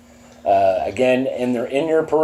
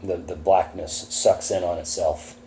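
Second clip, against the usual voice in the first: ominous GM narration; 4 semitones lower, 5 dB quieter than usual. Acted. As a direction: draw the players into the dark. Game master scene setting.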